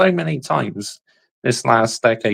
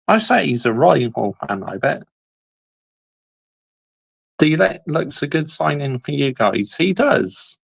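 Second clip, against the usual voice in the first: ordinary talk, nothing marked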